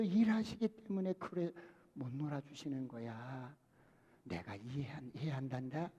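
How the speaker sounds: quiet reassuring mother voice; gentle mother. Retold or imitated. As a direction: soothe the kid about busy dad. Mother gently defending father to child.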